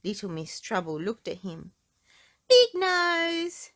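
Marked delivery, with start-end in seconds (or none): 2.5-3.7 high, loud cheeky jeer; taunting name-call. Acted. Miss Trouble to Mister Clever, a bratty high jeer. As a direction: sneer the nickname like a brat. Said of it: "she said".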